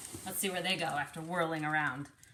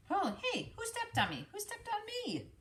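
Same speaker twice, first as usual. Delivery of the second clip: high squeaky complaint; offended little critter; small creature voice; 12 semitones higher than usual. Acted.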